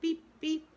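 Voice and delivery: high, loud honking beep; car horn beep. A narrator's reading. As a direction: blare it like a motor horn. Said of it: "with a loud".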